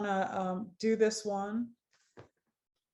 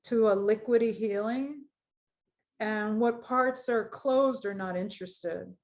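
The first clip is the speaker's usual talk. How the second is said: guided meditation. Acted. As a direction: ease them gently into the body scan. Leads a guided body scan.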